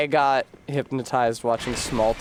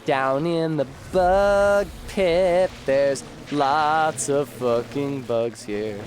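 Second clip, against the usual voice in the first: bimbo voice; 3 semitones higher, much slower than usual. Acted. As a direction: purr it like a ditzy bombshell. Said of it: "I'll do a bimbo voice for nine minutes".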